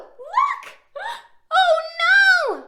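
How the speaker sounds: high gasping oh no; mock surprise. Reading aloud, mock shock at the canoe feet. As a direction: gasp and squeal for the kids.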